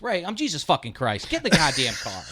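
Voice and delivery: loud fed-up snap at the uncle; dad finally losing it with the confused uncle, loud; exasperated yell. Retold or imitated. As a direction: lose patience and bark him into the car. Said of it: "And my old man goes".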